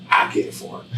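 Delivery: whispered offer to the cashier; quiet offer. Retold or imitated. Man quietly tells cashier he will pay, whispered. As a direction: breathe it low, just to her. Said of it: "so I was like".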